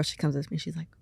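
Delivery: normal talking voice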